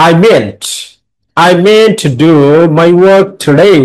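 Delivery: slow, with meant and work today punched; stress demo. Shown as a demonstration. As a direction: read it slowly and hit meant, work and the day of today. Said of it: "এই meant-টা হচ্ছে stress syllable".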